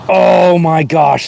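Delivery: high, loud, slow oh my gosh; dismayed gasp. Real, not acted. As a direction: stretch it out in shocked dismay. Finds dead rare snake.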